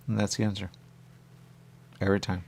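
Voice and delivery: usual speaking voice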